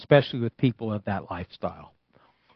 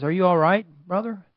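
lightly voiced, higher-pitched check-in; raised, questioning quote; concerned passenger; 8 semitones higher than usual. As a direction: gently check on a troubled stranger.

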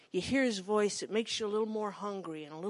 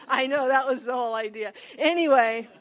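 laughing; 8 dB louder than usual. Real, not acted.

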